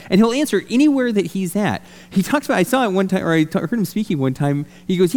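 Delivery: ordinary delivery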